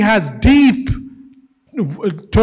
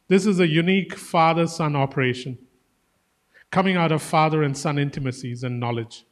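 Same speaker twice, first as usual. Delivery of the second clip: tender reading; 5 semitones lower, 6 dB quieter than usual. A narrator's reading. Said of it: "but now tenderly".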